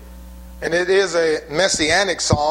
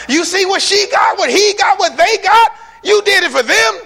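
envious complaint; 8 dB louder than usual. Retold or imitated. Voicing people's envious complaint.

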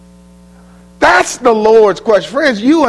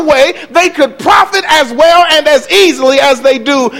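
raised, ringing pulpit voice, much higher and pressing on; preaching at full force; 10 semitones higher than usual. Acted. Preacher warning his flock, preaching at full force. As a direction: thunder it over the congregation. Preacher denouncing false teachers.

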